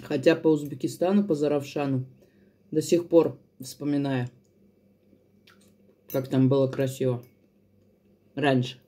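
talking while eating; chewing food. Real, not acted. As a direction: mouth partly full. Seen 4: Eats throughout, fork to mouth at 4.3 and 8.9, chewing visible.

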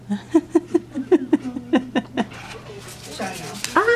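high breathy nervous giggle; real nervous giggle at the hospital; nervous laugh. Real, not acted.

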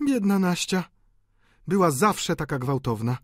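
high female character voice; pitying sister. A narrator's reading. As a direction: exclaim sympathy for a sister. Delphine pities her sister.

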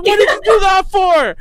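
high shouted protest, close to crying; hurt shout. Retold or imitated. Man struck from behind, shouting a high hurt protest. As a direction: yell it, wounded and baffled. Coworker hit with stick.

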